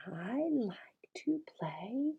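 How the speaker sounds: whispered, very soft; whispered prompt. Shown as a demonstration. Teacher whispering a prompt to a child. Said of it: "See, simple".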